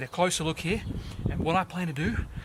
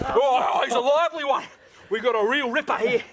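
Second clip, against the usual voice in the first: high, loud wrestling yells; hammed-up struggle with the beast; excited high shout; 9 semitones higher, 6 dB louder than usual. Pony fighting back.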